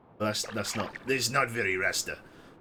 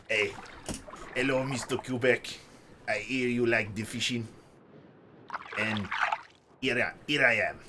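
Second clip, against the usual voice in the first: Rasta fish voice. Acted. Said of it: "Rastafarian trout".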